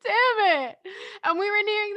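high, loud half-laughing wail; hammed-up despair at the bad timing; playful cry of defeat; mock frustration. Real, not acted.

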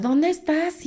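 high shouted question from afar; calling out. Retold or imitated. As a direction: neighbours yelling to find her. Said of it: "todos los vecinos empiezan a gritar".

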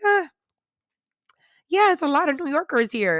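high-pitched mock chatty voice; imitating passengers. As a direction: mimic a breezy tourist's small talk. Imitates solo passengers talking.

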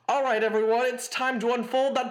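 lightly voiced radio host; a hint of a smooth radio announcer. Shown as a demonstration. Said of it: "I've gotta use my radio voice for this".

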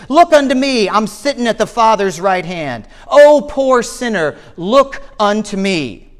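high, ringing refrain, each call lifted then falling; exhorting preacher. Acted. Lay preacher pleading with sinners in Christ's words, exhorting. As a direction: preach it as an insistent, rhythmic appeal. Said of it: "the good man followed up his text".

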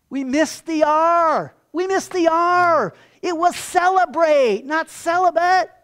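loud, high, slow comic lament; mock anguished wail. Head monk sobbing over the copying mistake, mock anguished wail. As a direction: wail each phrase out in hammed-up despair. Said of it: "He's just sobbing".

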